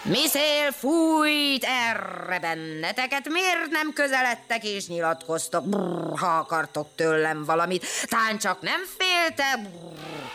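slow, booming big elephant voice with a rumble; elephant Kacifánt voice. Acted. Kacifánt the elephant calling the shy pair over, booming. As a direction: rumble like a friendly giant.

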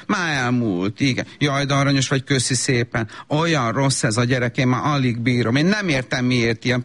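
ordinary delivery

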